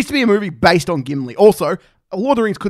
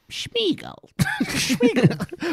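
high, soft creature voice; Sméagol; 5 dB quieter, much slower than usual. Acted. Fan imitating a favourite creature, high Sméagol voice. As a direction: squeak the name softly, then laugh.

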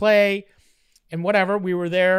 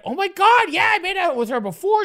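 high, louder oh my God; excited; 9 semitones higher, 5 dB louder than usual. Retold or imitated. Teen asked to prom by his crush, excited gush. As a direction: gush with delight. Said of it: "I was like".

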